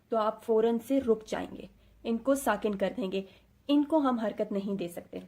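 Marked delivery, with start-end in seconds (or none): none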